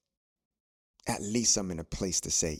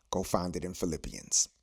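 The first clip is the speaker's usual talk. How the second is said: ordinary delivery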